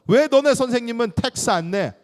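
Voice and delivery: loud, high, taunting interrogation; badgering challenge. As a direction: act out someone picking a fight. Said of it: "자꾸 따지니까".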